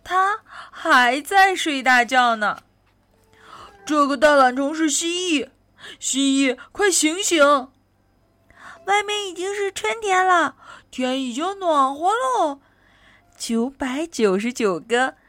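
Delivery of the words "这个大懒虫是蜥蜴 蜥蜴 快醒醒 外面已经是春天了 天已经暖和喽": higher-pitched wake-up call; lively storybook shout, moderately raised; calling out together. Acted. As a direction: call out to rouse a sleeper. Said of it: "起喊道".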